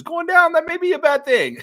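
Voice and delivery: high, loud laughing voice; laughing squeal. Real, not acted. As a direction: giggle it out high. Laughing high squeak about ratings.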